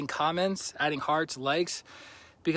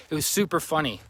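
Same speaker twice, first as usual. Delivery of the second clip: usual speaking voice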